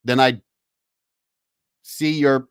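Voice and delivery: mild mock dressing-down; stern general. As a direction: put the lieutenant in his place. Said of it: "he looked over at him and he said".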